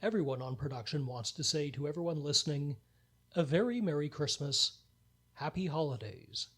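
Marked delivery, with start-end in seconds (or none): none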